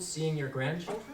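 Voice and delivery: ordinary delivery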